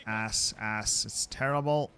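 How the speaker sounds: ordinary talk, nothing marked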